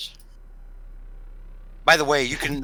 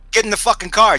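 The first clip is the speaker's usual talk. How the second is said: louder, higher acted command; thug's order; 9 semitones higher, 8 dB louder than usual. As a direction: order him around like a fed-up enforcer. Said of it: "I had him say a Cockney accent".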